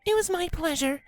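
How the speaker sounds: gentle Fluttershy voice. Acted. Fluttershy gently asks Rainbow, in a high timid voice. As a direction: speak as a shy, gentle pony.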